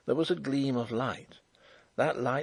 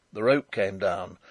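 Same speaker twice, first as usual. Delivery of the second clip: plain reading voice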